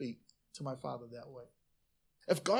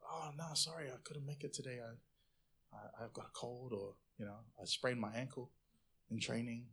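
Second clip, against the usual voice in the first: much softer, low apologetic mumble; sheepish excuse; 5 semitones lower, 13 dB quieter than usual. Retold or imitated. Player quietly excusing missed netball practice, sheepish and soft.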